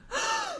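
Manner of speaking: sharp high gasp; dramatic gasp. Acted. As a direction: gasp in shock. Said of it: "Danny went over to it and gasped".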